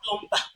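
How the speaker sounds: "plumber" with the silent B sounded; mispronunciation. Shown as a demonstration. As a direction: say "plumber" as plum-ba, with a B. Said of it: "not plumber".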